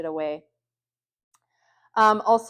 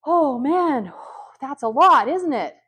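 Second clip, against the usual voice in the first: higher and louder, with an audible sigh; breathing out, deep sigh; 5 semitones higher, 8 dB louder, slower than usual. Shown as a demonstration. Modelling deep breathing for an angry kid, sighing out loud. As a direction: let the breath out big so they copy it. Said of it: "keep doing your own deep breathing".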